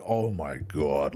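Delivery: low, slow "oh my God" of awe; re-enacted stunned reaction, deep and slow; retold awe. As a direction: drop low and draw it out, stunned. Retelling own reaction to game ending.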